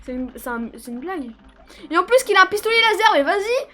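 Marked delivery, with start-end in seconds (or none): 1.9-3.7 fast, loud and high indignation; indignant. Real, not acted. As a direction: rant at the unfair enemy. Outraged at enemy laser.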